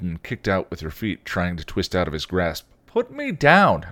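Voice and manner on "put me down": high, loud, drawn-out demand; indignant girl, pitched way up; protesting teen. A narrator's reading. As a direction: whine it like a grabbed kid sister. Girl struggling to get free.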